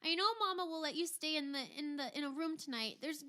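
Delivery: high, eager, tumbling offer; very high-pitched, excited admirer; Hilda. Acted. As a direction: offer it excitedly, tripping over the words. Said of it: "she says".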